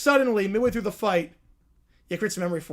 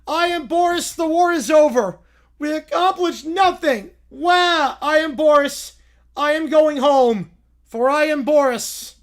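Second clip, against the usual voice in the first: loud, proud self-announcing bellow; booming Boris; 4 dB louder than usual. Acted. Boris tells the troops the war is over, loud and proud. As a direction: proclaim every word like a self-important general.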